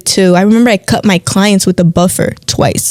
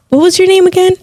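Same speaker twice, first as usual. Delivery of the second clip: chirpy customer, pitched up; imitating a client; 10 semitones higher, faster than usual. Imitating a client asking the nail tech's name, chirpy. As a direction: ask it like a curious client.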